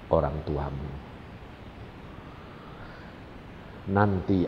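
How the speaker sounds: put-on tender and unhurried, like calming a child; gentle, loving. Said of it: "Lembut, sayang".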